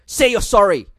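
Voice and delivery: firm, loud parental command; stern parent. Retold or imitated. Parent to a small child, a firm loud command. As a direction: bark the order at a small child.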